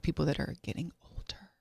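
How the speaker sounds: dropping into a near whisper; hushed. Real, not acted. Co-host naming older people while producer listens, hushed. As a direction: trail down to a discreet, breathy hush. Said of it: "We won't say it too loud".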